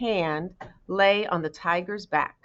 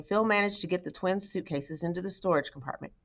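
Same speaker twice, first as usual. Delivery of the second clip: ordinary delivery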